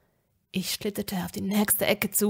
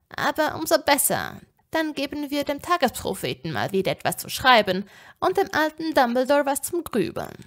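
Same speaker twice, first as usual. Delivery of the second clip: gloating villain; 5 semitones higher, 4 dB louder than usual. A narrator's reading. Possessing Tom gloats in Ginny's body.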